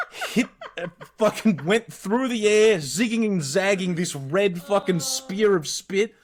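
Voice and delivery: excited slow-motion telling. Retold or imitated. Dramatic spit story climax.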